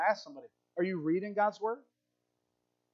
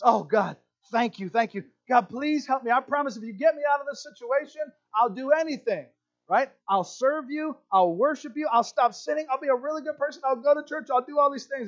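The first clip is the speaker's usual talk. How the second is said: higher, gushing promises; desperate pleading; 6 semitones higher than usual. Acted. Jack promising God anything to be rescued, pleading. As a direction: bargain with God, hammed-up and urgent.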